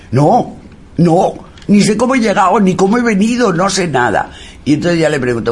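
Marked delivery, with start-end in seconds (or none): 0.1-4.3 high, flustered denial; high-pitched, agitated protest; mock frightened boy. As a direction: imitate a scared boy insisting he is lost. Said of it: "tenía un miedo, estaba más asustado".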